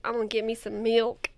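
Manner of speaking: soft Southern accent, words run loosely together; light imitation of a Southern accent; under-enunciated Southern accent. Shown as a demonstration. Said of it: "don't enunciate them".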